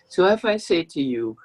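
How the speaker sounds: ordinary talk, nothing marked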